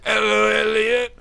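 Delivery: high, loud, slow monotone; drawn-out parroted greeting; addled old teacher. Acted. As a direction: call out blankly on one high note. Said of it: "I know that inflection".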